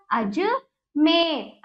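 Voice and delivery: Ajmer very slow in two parts; broken-up reading. Shown as a demonstration. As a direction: split Ajmer as अज, then a long मेर. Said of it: "तो इस प्रकार हमने इसको पढ़ा".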